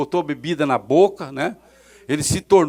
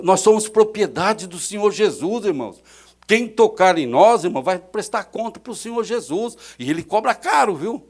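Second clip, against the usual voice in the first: fervent preaching warning; 5 semitones higher, 5 dB louder than usual. Acted.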